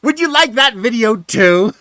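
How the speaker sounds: high, loud, slow taunting question; petty and nasty. Retold or imitated. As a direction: stretch out the offer as a loud jab. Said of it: "I decided to get petty and get nasty".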